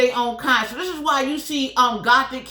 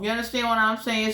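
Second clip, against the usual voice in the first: usual speaking voice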